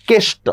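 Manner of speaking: কেষ্ট with a closed e. Shown as a demonstration. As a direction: say কেষ্ট as "keshto", e kept close. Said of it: "যুক্ত বর্ণ থাকলে এ ধ্বনির সংবৃত উচ্চারণ".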